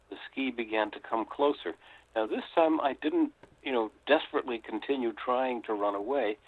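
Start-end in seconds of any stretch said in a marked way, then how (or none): none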